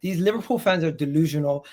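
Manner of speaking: usual speaking voice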